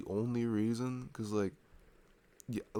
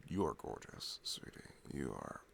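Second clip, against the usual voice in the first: tender; 7 semitones lower, 9 dB quieter than usual. Real, not acted. Tender compliment to partner.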